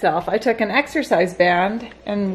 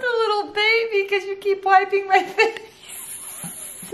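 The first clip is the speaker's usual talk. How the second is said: put-on whiny baby voice; 11 semitones higher, slower than usual. Mom voices baby son whining.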